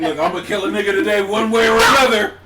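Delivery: loud laughing tough-cop voice; mock cop. Joking about the cop's attitude, loud laughing mock cop. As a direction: ham up a trigger-happy cop.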